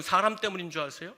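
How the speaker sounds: ordinary talk, nothing marked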